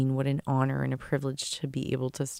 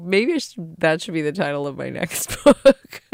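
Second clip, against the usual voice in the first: loud, laughing through the words; 3 semitones higher, 7 dB louder than usual. Real, not acted. Host joking about her next book title, laughing. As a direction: break into real laughter as you talk.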